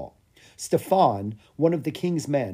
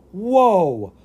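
high, loud wow of amazement; long, amazed exclamation, pitched up; excited cry; 7 semitones higher, 8 dB louder than usual. A narrator's reading. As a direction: cry out in wonder. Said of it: "Lucas cried".

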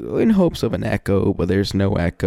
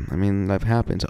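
normal talking voice

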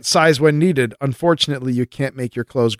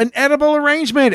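high excited; 12 semitones higher, faster than usual. Pretend.

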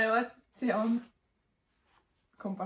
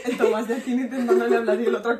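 talking through laughter, fast; laughing; 2 semitones higher, 9 dB louder, faster than usual. Real, not acted. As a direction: laugh while telling it fast. Friends laughing about philosophers. (hablando entre risas, rápido)

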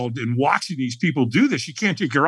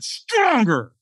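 high-pitched urging command; demanding trainer; 9 semitones higher than usual. Retold or imitated. Trainer pushing a hesitant firewalker, demanding. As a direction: push them for more, pitched up.

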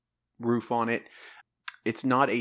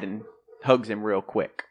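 normal talking voice

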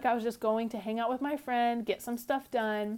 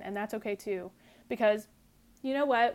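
usual speaking voice

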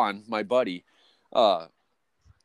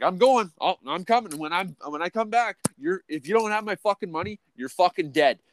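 high, strained mock threat; retold threat; 7 semitones higher than usual. Worker warning the boss about his money, high mock threat. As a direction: replay your own warning with heat.

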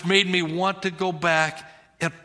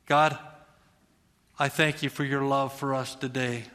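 prayer; 3 semitones lower than usual. Real, not acted. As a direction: pray gently over the room. Pastor prays aloud.

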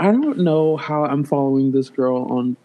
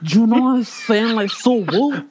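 high put-on slangy girl voice, slowing down; higher, slower mock of her accent; imitating a TikTok girl's voice; 4 semitones higher than usual. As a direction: mimic her affected way of talking. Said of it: "I don't even know how to imitate it".

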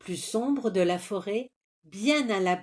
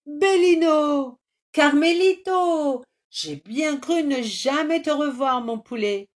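joyful reunion cries; 8 semitones higher, 6 dB louder than usual. Acted. Friends reunite after the rescue, overjoyed cries. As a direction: cry out in relief.

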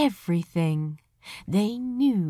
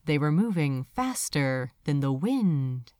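usual speaking voice

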